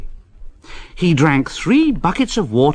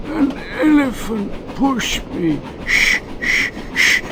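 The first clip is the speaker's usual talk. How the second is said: much higher voice, muttering then a hushed shh shh; a grumbling engine, steam hissing out; high, sulky engine hissing shh; hissing to himself; 11 semitones higher than usual. Acted. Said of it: "hissing to himself".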